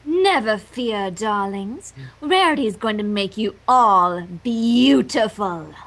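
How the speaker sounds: low, slow fake-sweet villain voice; deep mock-tender villainess; sinister sweetness. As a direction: purr it at the captives. Villain Rarity to captives.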